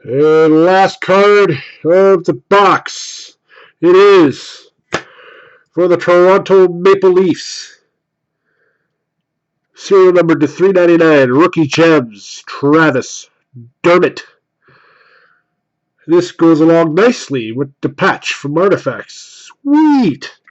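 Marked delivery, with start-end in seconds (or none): 0.0-14.2 card reveal announcer. Acted. Announces pulled cards like host. 19.6-20.4 high, loud burst of delight; real joy at the pull; excited. Real, not acted. Reacting to good card.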